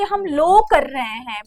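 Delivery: a student's wrong lo for लॉ; mimicked wrong pronunciation. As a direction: imitate students saying लॉ as lo. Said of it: "और कहीं के छात्र कहेंगे".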